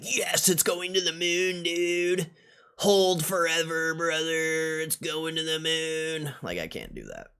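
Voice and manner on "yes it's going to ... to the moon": high, slow hype-man cheer; hyped troll voice. Pretend. Troll hyping viewers to hold the stock, high and slow. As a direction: pump up the crowd like a shameless shill. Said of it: "I can't be a troll and be like".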